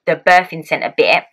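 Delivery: usual speaking voice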